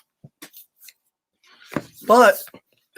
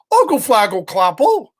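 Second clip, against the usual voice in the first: put-on toddler babble; 4 dB louder than usual. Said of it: "because she didn't know how to talk yet".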